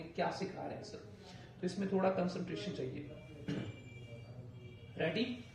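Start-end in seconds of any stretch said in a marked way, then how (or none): 0.0-1.1 soft unvoiced mock student muttering; confused student. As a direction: whisper it like a lost student. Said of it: "समझ नहीं आया तो आप बोलेंगे". (उलझे छात्र की धीमी फुसफुसाहट)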